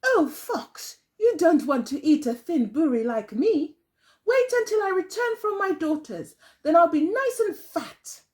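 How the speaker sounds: high, coaxing granny voice; pleading old woman. Acted. Old woman coaxing the hungry fox in a high voice. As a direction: talk the fox out of it sweetly.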